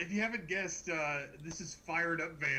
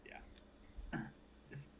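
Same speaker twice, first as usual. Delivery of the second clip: whisper; 13 dB quieter, much slower than usual. Real, not acted. Awkward silence, whispering on stream.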